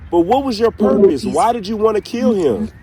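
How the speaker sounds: ordinary talk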